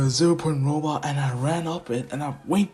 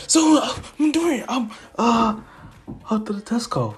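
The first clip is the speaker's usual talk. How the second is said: high-pitched, louder dad asking for news; eager father; 10 semitones higher, 5 dB louder than usual. Acted. Davenport to his son about the test, eager. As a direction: ask it high, like an excited dad.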